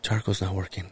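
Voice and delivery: low, hushed retold aside; failed discreet murmur. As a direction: imitate trying to keep bad news discreet. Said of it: "he's not being too quiet".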